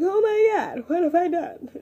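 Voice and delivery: mock horrified self; own panic. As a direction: play your own embarrassed panic. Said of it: "I'm just like".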